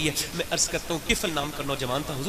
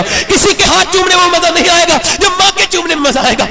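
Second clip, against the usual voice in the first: stronger repeat; 11 semitones higher, 14 dB louder than usual. Real, not acted. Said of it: "repeated for emphasis".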